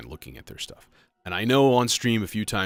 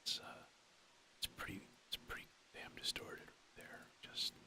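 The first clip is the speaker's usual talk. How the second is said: whispering; 14 dB quieter than usual. Real, not acted. Muttering aside while testing distortion.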